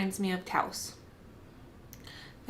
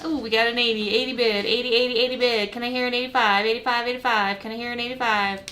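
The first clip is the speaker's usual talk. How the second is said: rapid repeating auction chant; auctioneer; faster than usual. Pretend. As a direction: rattle off the bids like an auctioneer. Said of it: "I'm surprisingly good at that".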